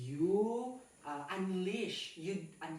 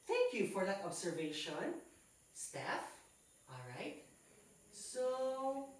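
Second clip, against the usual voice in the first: ordinary delivery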